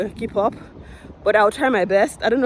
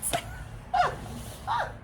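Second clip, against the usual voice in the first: high breathy laugh; 10 dB quieter than usual. Real, not acted. Laughing at the retold yell.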